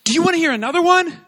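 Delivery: put-on high, loud, fast excited kid voice; an excited little boy begging to play again; eager child. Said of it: "he says".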